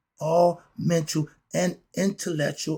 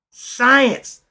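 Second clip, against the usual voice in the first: excited; 7 semitones higher, 9 dB louder than usual. Real, not acted. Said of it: "I get a little excited about this part".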